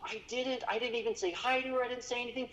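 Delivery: high, panicked near-crying cry of guilt; near tears. Retold or imitated. Guide realizing he forgot Mary, high and near tears. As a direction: let the voice climb into near tears. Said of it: "he almost cried".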